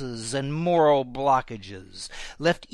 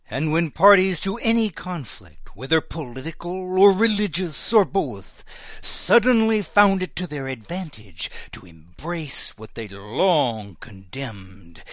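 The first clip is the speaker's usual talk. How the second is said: ordinary delivery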